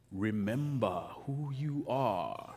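slow, low, soft fatherly voice; Simba's dad. Acted. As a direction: stretch it out like a solemn voice from the clouds. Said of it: "what does his dad say".

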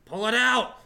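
loud, high-pitched shout; yelled command. Acted. Player's character yells at an ally to free the sword, loud high shout. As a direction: yell it urgently across a battlefield. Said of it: "I just yelled at Elagos".

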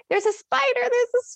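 freaked out. Retold or imitated. Telling him about the spider in a retold high panicky squeal. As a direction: squeal the warning in mock terror. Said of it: "I'm freaking out".

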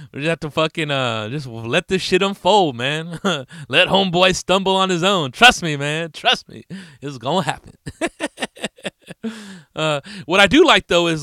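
normal talking voice

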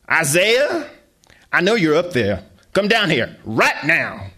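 loud, high call from downstairs; mother yelling up the stairs to her son; mom calling up the stairs. Acted. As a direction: shout up to the kid upstairs.

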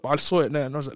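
normal talking voice

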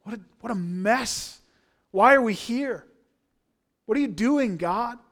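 pleading shout at God; crying out. Acted. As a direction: cry out in anguish. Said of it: "Just cry out".

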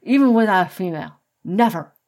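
loud, shaken refusal, rushed in the middle; shuddering refusal. A narrator's reading. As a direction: blurt it out as a frightened no. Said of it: "Metalhead shuddered".

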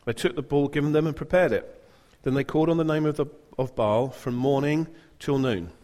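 ordinary talk, nothing marked

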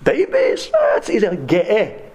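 very high-pitched mock German accent; squeaky caricature of a German accent; German doctor. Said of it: "I'll never forget his voice in my head".

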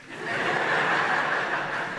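breathy, embarrassed laugh at himself; awkward laugh. Real, not acted. Pastor laughing at his own awkward spot on the sidewalk, sheepish laugh. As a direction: laugh it off, caught out.

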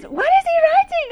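very high-pitched mock curious woman; imitating nosy visitor. Visitor asking about the writing, high mock curiosity. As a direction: ask it in a hammed-up high, loud voice. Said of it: "And she says".

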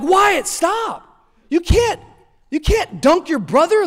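high, exasperated parental telling-off; scolding dad. Retold or imitated. Dad stopping his son dunking his brother, scolding.

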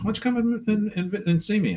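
high, friendly mock voice of an old painter friend; Frank's invitation. Old friend Frank on the phone inviting him, high and friendly. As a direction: lift the pitch way up, inviting. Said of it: "He goes".

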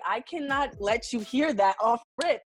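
usual speaking voice

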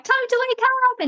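high, playful toy panda voice; squeaky pretend panda waking the child. Mother voicing panda to wake daughter, high and playful. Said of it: "And I, like, be the panda".

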